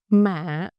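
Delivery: "Mạ" said as mả, with a dip; dipping tone. Shown as a demonstration. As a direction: let "Mạ" sink into a dip. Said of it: "is dipping".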